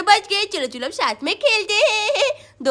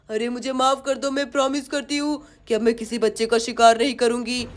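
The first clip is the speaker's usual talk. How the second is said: pleading witch; 9 semitones lower than usual. Acted. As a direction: beg sorry as the witch. Witch begs forgiveness. (माफ़ी माँगती चुड़ैल)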